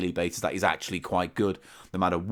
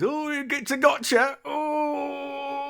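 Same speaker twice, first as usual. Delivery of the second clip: much higher, slower put-on voice, a little cry at the end; character impression; much slower than usual. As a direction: squeak it out like a startled little man in a hat. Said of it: "and goes".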